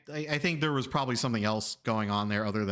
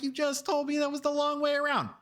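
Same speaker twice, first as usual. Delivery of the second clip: high-pitched indignant comeback; exasperated protest; 14 semitones higher than usual. Retold or imitated. Driver arguing back at contradictory passenger, high exasperated protest.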